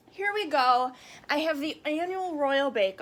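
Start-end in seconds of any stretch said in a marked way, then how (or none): none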